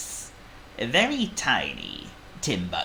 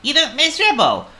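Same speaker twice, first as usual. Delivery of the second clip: high, loud little worm voice; worm character; 10 dB louder, faster than usual. A narrator's reading. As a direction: pipe up as a perky worm. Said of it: "the worm beside whose hell Squeak".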